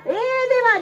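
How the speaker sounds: high-pitched call to God. Acted. Janabai calls God from the grinding stone, calling out. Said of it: "देवाला हाक मारल्याबरोबर".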